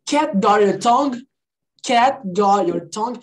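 slow demo, "Cat got your tongue" with T's unreleased; teacher slowly modelling "Cat got your tongue"; spoken pronunciation. Shown as a demonstration. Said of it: "eu sempre vou falar na pronúncia".

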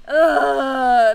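loud frustrated groan of disgust; angry groan. Real, not acted. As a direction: let out a loud fed-up groan. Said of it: "it makes me so mad".

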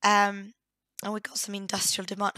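ordinary delivery

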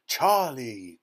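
loud, delighted shout of a name; Mister Wonka, crying out. A narrator's reading. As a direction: exclaim the name in joyful recognition. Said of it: "cried Mister Wonka".

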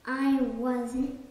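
ordinary delivery

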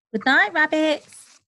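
a cheerful high goodnight; bright call. A narrator's reading. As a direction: call goodnight across the room.

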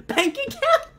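very high laughing question; laughing squeak. Real, not acted. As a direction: squeak it out in disbelief.

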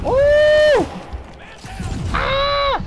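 loud high yelp of surprise; sudden high gamer yelp; excited yelp. Real, not acted. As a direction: yelp as the hit lands. Hit in a game fight.

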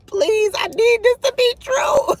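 high, wailing plea; desperate pleading. Real, not acted. Host begging viewers that the rumour be true, pleading. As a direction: beg in a rising, tearful-sounding wail.

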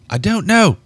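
high frightened outburst; frightened cry. Acted. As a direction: cry it out in fear. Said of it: "she cried".

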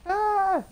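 loud, high yell of frustration; sudden high cry of dismay; frustrated yell. Real, not acted. Player in an annoying boss fight, frustrated yell.

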